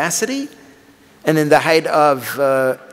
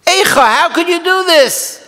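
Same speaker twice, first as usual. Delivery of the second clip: Eicha cried out much higher and louder; anguished cry; 6 dB louder than usual. Shown as a demonstration. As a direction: wail the question up to heaven. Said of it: "How can we say".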